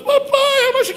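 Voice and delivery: high-pitched mock sobbing child; tearful small-child voice, imitated; crying little girl. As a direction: whine it like a hurt toddler. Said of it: "ela começou a chorar".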